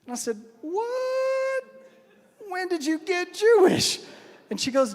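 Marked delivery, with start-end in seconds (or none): 0.6-4.0 high, drawn-out shock, mock incredulous; astonished. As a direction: squeal it in exaggerated disbelief. Astonished husband in retold argument.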